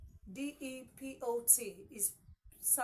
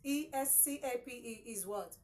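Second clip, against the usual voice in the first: ordinary delivery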